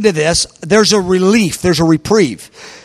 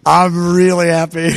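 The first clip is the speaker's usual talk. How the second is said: slow, lightly acted contentment; smiling, slow and drawn out; retold happiness; 2 semitones lower than usual. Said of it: "I'm smiling".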